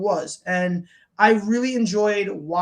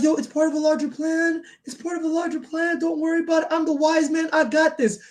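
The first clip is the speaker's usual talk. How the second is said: lightly voiced higher pleading Paul; imitating Paul Heyman; 7 semitones higher than usual. Paul Heyman reassuring Roman, lightly mimicked higher. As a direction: play the nervous wise man, pitched up. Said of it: "He's like".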